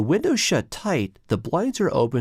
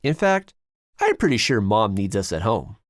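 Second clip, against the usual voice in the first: plain reading voice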